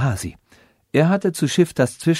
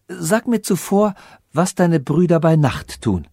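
higher, wary question; suspicious princess; 6 semitones higher than usual. Acted. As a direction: test him with suspicion. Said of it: "sank Argwohn in ihr Herz".

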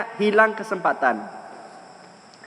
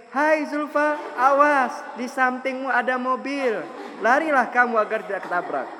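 long-winded warning; 7 semitones higher than usual. Acted. Passer-by warning Zulfa of a car, comically long-winded. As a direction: deliver the whole warning without any haste. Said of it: "Ente ngomongnya kelamaan".

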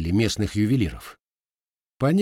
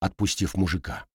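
ordinary delivery

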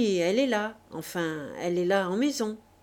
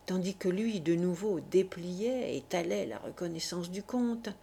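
ordinary delivery

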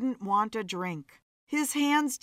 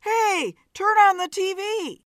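urgent high-pitched shouting; screamed; 10 semitones higher, 6 dB louder than usual. A narrator's reading. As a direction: shout it high and loud across a bar. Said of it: "he screamed".

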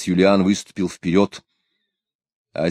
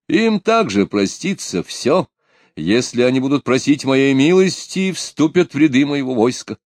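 proclamation; 5 semitones higher, 3 dB louder than usual. A narrator's reading. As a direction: proclaim it like a tsar. Pugachev's speech continues.

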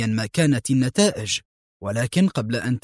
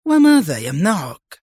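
higher, sharp exclamation; heated, raised-pitch retort; sharp challenge; 4 semitones higher, 4 dB louder than usual. A narrator's reading. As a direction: throw the question out as a sharp dare. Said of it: "هتف موشي في حدة".

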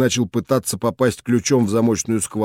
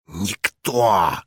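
hissed reply; 4 semitones lower than usual. A narrator's reading. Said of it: "зашипели преподаватели".

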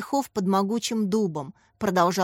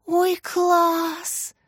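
high, thrilled little squeak; high-pitched girlish rapture; delighted squeal; 9 semitones higher than usual. A narrator's reading. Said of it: "пропищала в восторге Яся".

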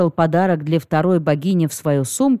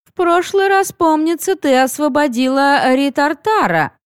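higher, smooth flowing voice; flowing goddess voice; 9 semitones higher than usual. A narrator's reading. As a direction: let the words flow like water. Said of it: "раздался текучий голос ещё одной богини".

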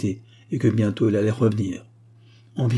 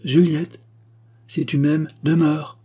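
high, loud, insistent appeal; urgent command; 6 semitones higher, 4 dB louder, slower than usual. A narrator's reading. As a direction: call her back, pleading firmly. Mother urgently holds daughter back.

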